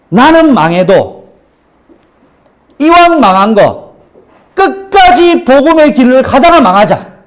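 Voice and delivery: solemn resolve. Acted. Voices his resolve, slow and building.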